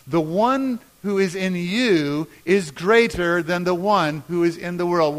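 strong, measured group reading; leading a recitation. Shown as a demonstration. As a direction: lead the room through the verse. Leads congregation in saying verse.

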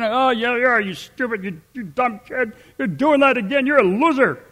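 a parent mocking their child, sneering and scornful. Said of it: "Telling our kids when they're doing something stupid".

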